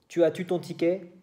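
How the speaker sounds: Quebec tu as-tu, rising; Quebec question. Shown as a demonstration. Showing students the Quebec question form, rising tu. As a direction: lift the doubled tu Quebec style.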